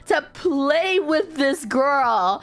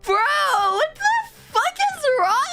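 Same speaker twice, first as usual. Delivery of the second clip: retold exasperation; 12 semitones higher than usual. Retold outburst, voice cracking high.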